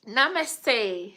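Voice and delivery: high, loud namaste to the chat; loud greeting. Real, not acted. Vlogger greeting viewers with a loud, excited call. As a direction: greet them with a bright call.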